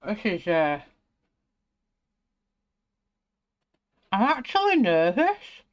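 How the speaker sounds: talking round a pencil in mouth. Real, not acted. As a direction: speak with a pencil held in mouth. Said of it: "talking to you with a pencil in my mouth".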